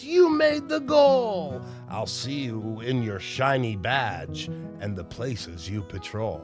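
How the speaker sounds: plain reading voice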